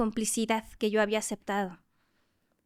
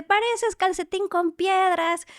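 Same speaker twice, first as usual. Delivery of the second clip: teasing coach; 8 semitones higher, 5 dB louder, slower than usual. Retold or imitated. La entrenadora se estaba riendo.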